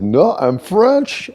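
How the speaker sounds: a mocking French accent, drawn out; French accent impression. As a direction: mimic the clerk in a hammy French accent. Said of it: "He said".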